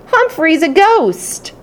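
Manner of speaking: put-on high, slow child's shout; delighted kid voice. Classmates shouting at the ghost, slow high childish delight. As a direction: squeal it like a thrilled kid. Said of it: "then shouts of".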